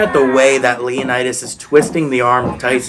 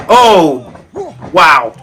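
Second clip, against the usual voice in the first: excited; 4 semitones higher, slower than usual. Real, not acted. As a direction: react to a huge impact. Commentator reacting to big move.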